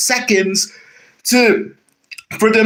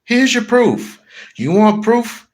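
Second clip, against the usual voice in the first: ordinary talk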